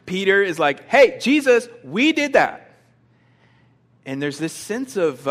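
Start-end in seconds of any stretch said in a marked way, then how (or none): 0.9-2.7 loud, high-pitched eager call; excited boast. Acted. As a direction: call out proudly for the teacher's attention. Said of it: "he's like real excited".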